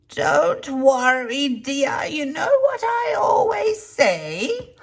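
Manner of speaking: put-on high, slow, sweet old lady voice; imitating an old lady. Neighbour in the next stall comforting the embarrassed speaker, high slow old lady voice. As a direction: coo it out slowly like a kindly old lady. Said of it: "this is the best voice that I can remember her saying it in".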